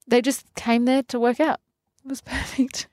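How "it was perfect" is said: soft, happy chuckle mid-line; laughing. Real, not acted. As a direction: laugh through the word perfect, a bit softer. Laughing while recalling event.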